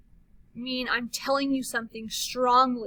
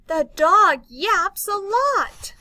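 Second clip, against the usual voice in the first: higher, louder and slow, full of excitement; excited reading; 7 semitones higher, 9 dB louder, slower than usual. Shown as a demonstration. Teacher reading an exclamation to kids, excited. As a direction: make the dog sentence thrilling. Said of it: "Let's do it together".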